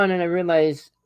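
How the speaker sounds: ordinary talk, nothing marked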